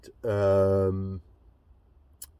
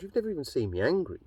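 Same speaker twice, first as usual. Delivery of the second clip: rattled off, higher and fast; quick higher-pitched comeback; quick retort; 6 semitones higher, much faster than usual. Retold or imitated. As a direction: fire it back fast and sharp. Retold reply to the bloke.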